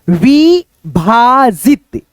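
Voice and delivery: विभाजित drawn out very slowly, each syllable clear; slow syllable demo. Shown as a demonstration. Teacher showing students how to read विभाजित, very slowly. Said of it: "यहां पे देखो कैसे पढ़ेंगे इसको".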